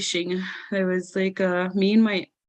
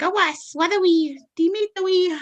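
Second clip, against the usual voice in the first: grandma's voice; 11 semitones higher, 4 dB louder than usual. Retold or imitated. Grandma answers in her language.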